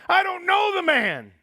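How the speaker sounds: high, loud insistent denial. Acted. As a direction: deny it hard, with force. Peter denying with an oath.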